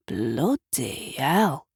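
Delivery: low, slow mutter under the breath; a grumbled, awed curse; slow low-voiced swear; muttered oath. A narrator's reading. Gavin swears at the lit room.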